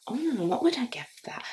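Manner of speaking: hushed, quick, half-whispered aside; musing to self. Real, not acted. Reviewer wondering what score to give, musing quietly. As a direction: think it over under your breath. Thinking aloud about the rating.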